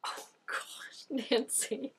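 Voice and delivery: breathless laughing whisper. Real, not acted. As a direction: whisper her name through a laugh.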